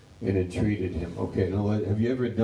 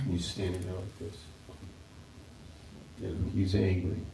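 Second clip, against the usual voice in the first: ordinary delivery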